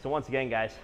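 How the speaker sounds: catching breath between phrases; breathless after a workout. Real, not acted. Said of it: "sorry, I'm catching my breath still".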